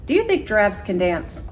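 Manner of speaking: usual speaking voice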